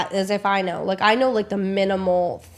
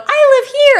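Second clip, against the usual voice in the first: high, loud mock outburst; mock indignant shout; 12 dB louder, slower than usual. Jason at the driver, shouted high and loud. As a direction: blurt it out way up high.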